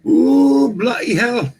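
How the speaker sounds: put-on high, playful British accent, lightly done; a hammed-up British Ooh, bloody hell; mock British exclamation. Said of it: "we got the Queen of England here".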